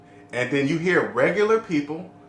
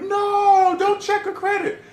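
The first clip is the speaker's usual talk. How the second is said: high-pitched, drawn-out panicked yell in imitation; mock scream; 3 dB louder, slower than usual. Vlogger retelling a TV commercial husband, mock scream. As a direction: imitate a man yelling out a frantic no. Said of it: "the guy's screaming over like".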